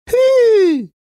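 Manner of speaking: shrill high call to the engine; a golden mannikin calling out loud and high; little golden man urging on his train; loud whistle-call. Acted. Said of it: "pfiff er laut".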